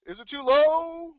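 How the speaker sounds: high, loud, overblown demo of a voice that's too loud. Shown as a demonstration. Coach showing agents a voice that's too loud, hammed-up shout. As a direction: blast it out to show too much volume. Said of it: "What's their volume?".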